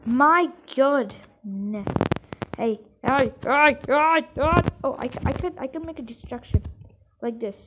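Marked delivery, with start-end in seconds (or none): none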